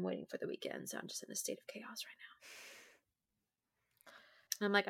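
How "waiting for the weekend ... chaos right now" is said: whispering. Real, not acted. Whispered aside.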